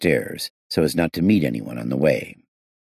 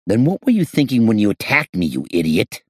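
angry; 7 semitones higher, 5 dB louder than usual. A narrator's reading. Inspector yells at attacker.

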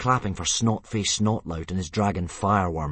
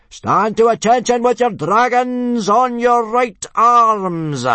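very high, slow, drawn-out bellow; parade-ground yell; 13 dB louder, slower than usual. A narrator's reading. Instructor ordering boys to attention, long yell. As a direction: stretch the command out at full volume. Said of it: "yelled Gobber the Belch".

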